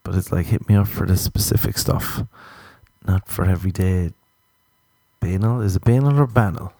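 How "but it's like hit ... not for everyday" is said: ordinary talk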